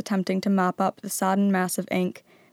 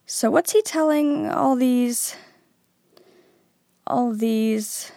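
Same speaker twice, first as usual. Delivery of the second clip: slow "all these", repeated after a pause and fading; trailing away; 5 semitones higher, slower than usual. A narrator's reading. Harry puzzling aloud, "all these" trailing away. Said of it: "Harry's voice trailed away".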